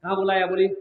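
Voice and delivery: normal talking voice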